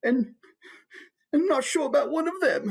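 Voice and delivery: put-on high, wistful old monarch voice; sad king. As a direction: lament a dwindled kingdom in a high voice. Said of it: "kind of sad, like".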